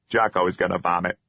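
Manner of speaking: fast, lightly voiced troll in mild panic; hurried fretful troll. A narrator's reading. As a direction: blurt it like a scared little creature. Troll pleading to leave.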